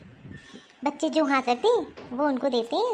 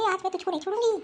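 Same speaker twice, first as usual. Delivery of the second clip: normal talking voice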